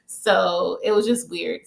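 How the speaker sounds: normal talking voice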